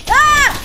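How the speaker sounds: loud sudden cry of alarm; startled yelp. Real, not acted. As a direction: yelp out in shock. Taking hits in fight.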